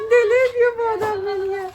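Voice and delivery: mock crying. Wife jokingly complains about her husband to viewers, mock sobbing. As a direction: pretend to wail in jest over her husband. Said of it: "Neyse arkadaşlar şakayı bırakalım".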